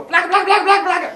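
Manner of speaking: high loud monster babble. Retold or imitated. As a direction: bellow it like a dumb giant. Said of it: "the big ugly monsters go".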